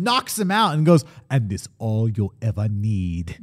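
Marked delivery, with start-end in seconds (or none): 1.3-3.4 low Austrian one-liner, dry and flat; Arnold one-liner. Retold or imitated. Host quoting Arnold's first one-liner in a low Austrian voice. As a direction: deliver the kill line like Arnold. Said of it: "Arnold Schwarzenegger, like, punches him and goes".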